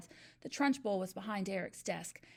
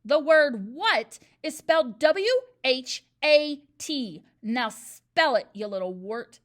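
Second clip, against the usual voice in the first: much louder, slow, spelling it out; shouting; 3 semitones higher, 13 dB louder, slower than usual. A narrator's reading. Trunchbull shouts the spelling at the dangling boy. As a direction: hammer each letter home. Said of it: "The Trunchbull was shouting".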